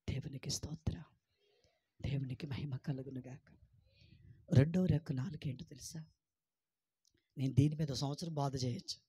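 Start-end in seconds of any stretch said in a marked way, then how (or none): 0.1-3.5 whisper. Real, not acted. Quiet praise after confession.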